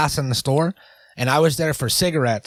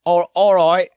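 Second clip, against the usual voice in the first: higher, louder mock English accent; 6 semitones higher, 5 dB louder than usual. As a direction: aim for Australian, land on English. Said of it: "that's not I'm doing English".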